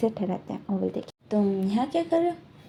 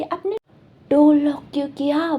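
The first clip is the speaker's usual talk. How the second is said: a half-hearted yell, a bit louder; mock shout; 4 semitones higher, 4 dB louder than usual. Boy repeats the question into the tutor's ear, in a mild mock yell. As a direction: yell it into his ear, not full force. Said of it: "चिल्ला के उसके कान में बोलते".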